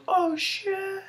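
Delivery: high squeaky oh shit; hammed-up startled falsetto; mock panicked yelp. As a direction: shriek it as he flies off. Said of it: "He goes".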